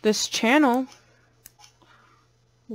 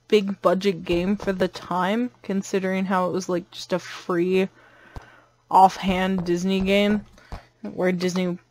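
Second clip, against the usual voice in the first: usual speaking voice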